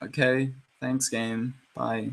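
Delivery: quiet, deadpan mock gratitude; soft, slow, trailing-off acted reply; underwhelmed player. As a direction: mumble it like a let-down player giving up. Voices the let-down player replying.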